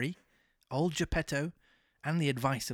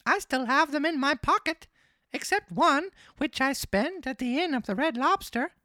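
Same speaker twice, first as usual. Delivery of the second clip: very high, loud puppet voice; marionette voice; 13 semitones higher, 6 dB louder than usual. Acted. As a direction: pitch it way up as the naive little marionette. Pinocchio answers the fox.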